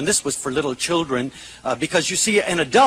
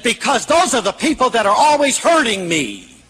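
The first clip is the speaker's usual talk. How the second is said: higher, emphatic protest; indignant child's retort; 6 semitones higher, 4 dB louder than usual. Retold or imitated. Little girl explaining her smashing to therapist, high and indignant. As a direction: imitate an indignant child, pitched up.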